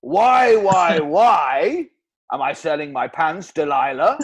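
loud, swooping Welsh crooner; Welsh accent. Acted. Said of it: "Bloody Welsh accent again".

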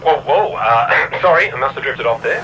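loud startled waking; jolted awake. Acted. Captain jolts awake mid-flight, loud and flustered.

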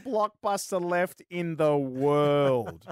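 slow, stretched-out line with a long last word; drawn-out repeat. Real, not acted. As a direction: draw every word out for effect. Repeats line for mocking effect.

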